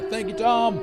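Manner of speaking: high, bright thank you; excited thanks. Real, not acted. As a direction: thank the raider with glee.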